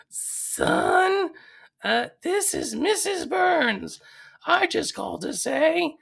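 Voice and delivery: very high, slow falsetto mother; Homer's fake old lady. Homer posing as Burns's mother disowns him, slow high falsetto. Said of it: "doing this fake old lady's voice".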